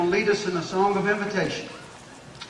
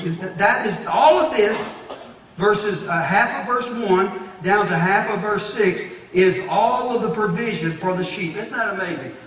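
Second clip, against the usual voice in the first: higher and louder, driving on; full-force preaching; 4 semitones higher, 5 dB louder than usual. Real, not acted. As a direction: preach with rising intensity.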